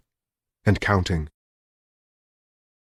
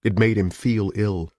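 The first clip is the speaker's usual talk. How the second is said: ordinary delivery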